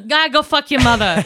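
hammed-up Boston tough talk; Boston accent. Shown as a demonstration. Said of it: "let me do my Boston accent".

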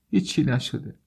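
put-on small, high-pitched crying boy; high voice of a tearful boy, brushing it off. A narrator's reading. Weeping boy answers the worried stranger, tearfully. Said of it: "صدای گریهٔ پسرک بلند شد".

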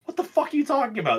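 high, rapid mock-baffled outcry; retold bafflement. Retelling how friends reacted to the joke, mock bafflement. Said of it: "everyone when they got picked was just like".

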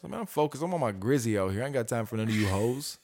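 normal talking voice

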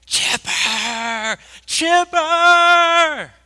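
high, loud drawn-out hollers; imitated fan yell. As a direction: yell for your favourite player, stretching the last syllable. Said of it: "he just yells".

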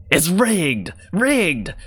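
high-pitched outburst of a sore loser; angry accusation. Acted. Losing gambler slams the table and accuses the house, angry outburst.

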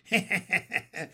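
staccato gloating laugh; dry froggy cackle; bullfrog cackle. A narrator's reading. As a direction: chuckle raspily like a bullfrog. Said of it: "said the bullfrog".

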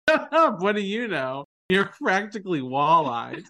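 high-pitched, punchy mock comeback; retold sharp comeback. Host quoting Abby's comeback to a friend, mock firing back. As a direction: quote her firing back, pitch jumping up. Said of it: "The way she fires back".

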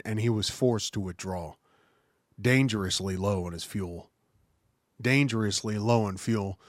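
formal, measured citation reading; crisp military citation. Acted. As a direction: read it like an official commendation. Reads the Medal of Honor citation.

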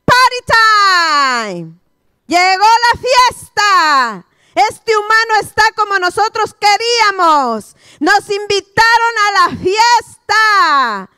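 high, loud, slow gleeful party animals; mocking pigs and dogs. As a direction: cheer like filthy beasts arriving at a feast. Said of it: "y dicen".

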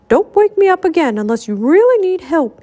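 firm, high-pitched order; stern, emphatic warning. A narrator's reading. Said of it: "he ordered".

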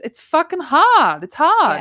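exasperated outburst. Real, not acted. Mother venting to her co-host about parenting, exasperated outburst. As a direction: blurt out how hard it is.

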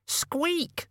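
very high, thin rodent squeak; piercingly high squeak; rat squeak. A narrator's reading. Death of Rats squeaks.

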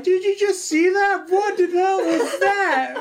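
high, loud incredulous laughing quote; amazed laughing disbelief. Retold or imitated. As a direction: squeal it to your friend on the phone. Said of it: "we call each other like".

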